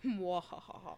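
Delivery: evil cackle; villainous mwahaha laugh; mad scientist. Retold or imitated. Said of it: "I think her brother knows it and is like".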